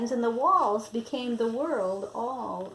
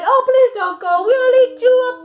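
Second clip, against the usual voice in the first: much higher, loud begging wail; pleading cry; 10 semitones higher, 15 dB louder than usual. Acted. Wild things begging Max to stay, high loud pleading. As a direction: beg him not to leave. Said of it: "the wild things cried".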